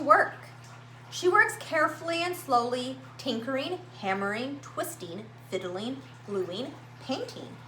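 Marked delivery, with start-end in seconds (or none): none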